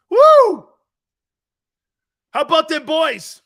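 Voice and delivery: normal talking voice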